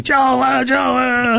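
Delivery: high excited greeting shout across the bus. Retold or imitated. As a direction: call out his name, thrilled. Band greeting him on bus.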